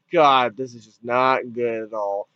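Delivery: low, unimpressed groan of words; unimpressed reaction. Retold or imitated. Viewer reacting to a badly written scene, unimpressed. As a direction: sink low in disappointment.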